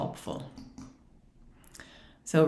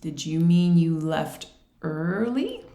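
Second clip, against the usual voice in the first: slow question, "early" drawn out and rising; teacher slowly offering "early" as a question; prompting question; slower than usual. Acted. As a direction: stretch "early" upward to prompt the student. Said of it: "teacher can say".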